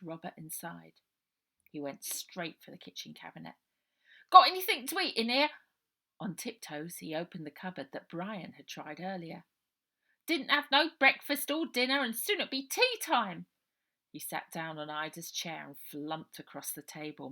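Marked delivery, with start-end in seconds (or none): 4.3-13.5 much louder, higher, fast cockney lad; hungry street boy. Acted. Robert raiding Ida's cupboard, loud hungry cockney boy. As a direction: rummage and grumble about no food.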